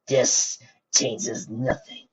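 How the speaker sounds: slow, strained through pain; strained defiance. Acted. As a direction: force each word out through pain. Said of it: "replied over the hissing and groaning".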